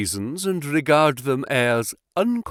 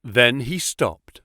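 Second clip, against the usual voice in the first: plain reading voice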